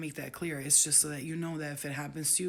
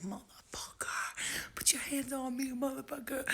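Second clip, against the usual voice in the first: whispered mock yell; 7 semitones higher, 6 dB quieter than usual. Retold yell, done whispered.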